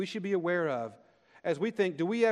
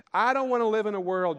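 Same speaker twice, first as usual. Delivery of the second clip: higher, louder indignant protest; indignant Jonah; 6 semitones higher, 6 dB louder than usual. Retold or imitated. Preacher voicing sulking Jonah to God, indignant outburst. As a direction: make Jonah's refusal burst out. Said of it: "Jonah might say".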